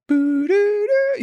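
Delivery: high sung disconnected-line tone, slow; imitated phone disconnect tone. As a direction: mimic the three-note disconnected signal, high and drawn out.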